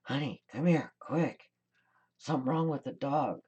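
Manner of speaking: a high, loud mock yell across the house; imitating a yell. Husband yelling to his wife across the house, mock alarmed shout. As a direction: call out in alarm like a worried husband. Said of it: "my husband yells across the house".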